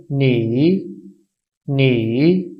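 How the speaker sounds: नी slowly falling then rising; third tone demo. Shown as a demonstration. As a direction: dip the voice on नी and lift it back up. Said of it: "इसकी pronunciation क्या होगी?". (नी तीसरी tone में, गिरा के उठा के)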